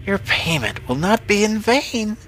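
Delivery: high, rising witch voice. A narrator's reading. Witch accepting the farmer's payment, high witch voice. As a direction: promise it in an eerie high witch voice.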